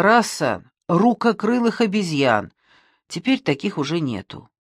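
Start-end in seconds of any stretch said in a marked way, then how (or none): none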